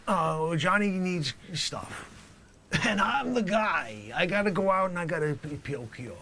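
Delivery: comic dealer introducing himself; loud character voice. Acted. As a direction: brag it like a proud dealer.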